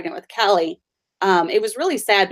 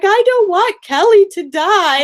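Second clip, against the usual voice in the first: high, louder mock wail of a little girl; imitating a crying child; 12 semitones higher, 6 dB louder, slower than usual. Mother retelling her daughter's tears in a high wail. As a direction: mimic a tearful four-year-old. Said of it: "started crying".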